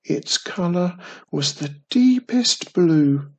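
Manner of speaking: ordinary delivery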